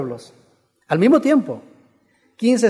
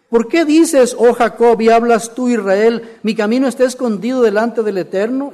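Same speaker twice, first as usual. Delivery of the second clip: loud, raised declamation, easing as it goes; preaching scripture reading; 3 semitones higher, 6 dB louder than usual. Acted. Preacher reading the verse to the congregation, declaimed question.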